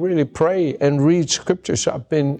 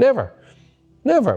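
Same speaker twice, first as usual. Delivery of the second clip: emphatic never; 11 semitones higher, faster than usual. Real, not acted. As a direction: fling out each Never as a high shout. Emphatic preaching denial.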